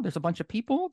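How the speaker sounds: ordinary delivery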